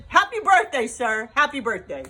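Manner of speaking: loud, high, excited birthday cheer; hearty yelled congratulations; cheering shout. Real, not acted. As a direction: shout the wishes like a toast.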